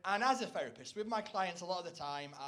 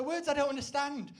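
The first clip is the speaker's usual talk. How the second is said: ordinary talk, nothing marked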